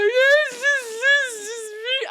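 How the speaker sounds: high, slow, crying wail; retold bawling. Telling her friend after the fight, high crying wail. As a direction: wail it out through tears. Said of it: "I'm, like, bawling".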